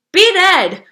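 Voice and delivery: high, loud urgent cry; frantic loud command; cried out. A narrator's reading. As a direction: shout it in a panic. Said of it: "Bongo cried".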